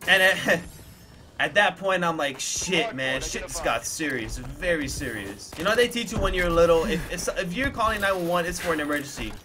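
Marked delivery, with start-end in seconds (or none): 2.4-5.5 slow, low and sober; grave realization. Retold or imitated. Storyteller voicing his younger self at the emergency call, grave realization. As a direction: let it sink in slowly, dropping lower. Retells his dawning fear.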